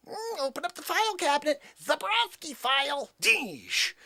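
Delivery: high mocking cheese-grater voice; [name]'s cheese grater voice. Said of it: "Cheese grater voice".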